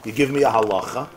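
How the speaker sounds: usual speaking voice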